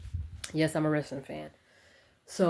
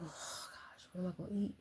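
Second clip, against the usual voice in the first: barely audible, breathy muttering under her breath; quiet mutter to herself; 2 semitones higher, 14 dB quieter than usual. Real, not acted. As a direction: mutter it to yourself, almost no voice.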